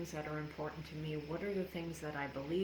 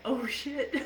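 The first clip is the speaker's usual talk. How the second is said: laughing shock; 8 semitones higher, 7 dB louder than usual. Retold or imitated. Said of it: "I'm like".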